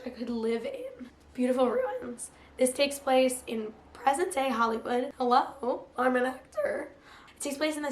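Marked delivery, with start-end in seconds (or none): none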